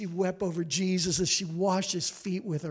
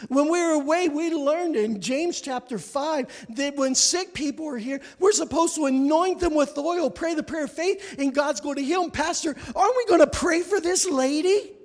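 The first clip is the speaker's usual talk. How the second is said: higher-pitched, urgent retold challenge; earnest teenage girl; 6 semitones higher than usual. Teenage girl urging pastor to pray for sick lady, insistent.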